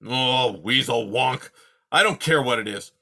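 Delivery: put-on loud grumpy old creature voice; gruff old porcupine. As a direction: grumble like a cranky old porcupine. Aerith speaking.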